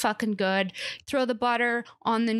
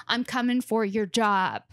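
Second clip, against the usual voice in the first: ordinary delivery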